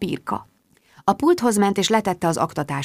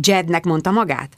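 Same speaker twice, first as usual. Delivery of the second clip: ordinary delivery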